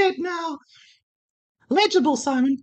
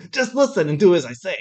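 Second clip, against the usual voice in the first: lower, fast command; low bossy male voice; 8 semitones lower, faster than usual. Acted. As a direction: order her about.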